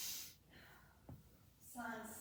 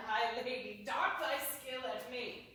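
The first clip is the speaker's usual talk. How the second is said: ordinary delivery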